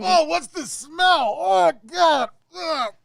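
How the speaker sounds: high, drawn-out wail of disgust; disgusted conductor. Acted. Blinded conductor reeling from the smell of goo, wailing in disgust. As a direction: wail it high and slow, revolted.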